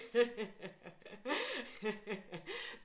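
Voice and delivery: long bubbling laugh that rises; free laughter. Shown as a demonstration. Teacher laughing with the class after the chant: open laughter. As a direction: let the laugh roll out. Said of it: "And say this with me".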